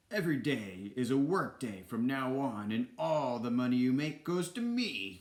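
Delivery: emphatic, domineering proclamation; hammed-up bossy villain king; Ratigan the king. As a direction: punch the key words like a greedy tyrant. Villain lays down the law.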